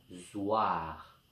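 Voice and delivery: « zoir » isolé et détaché, plus bas et doux; détacher la syllabe « zoir » seule; syllabe détachée. Shown as a demonstration. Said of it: "dans la dernière syllabe". (syllabe « zoir » articulée à part pour les enfants)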